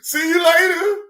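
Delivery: very high, loud singsong taunt; mocking high farewell. Streamer voicing YouTube waving him off, high squeaky taunt. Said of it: "They said".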